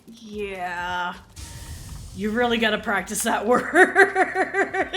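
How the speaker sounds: imitating the tutor, laughing through the words. Tutor teasing her student over the mistaken word, laughing. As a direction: tease the student while cracking up.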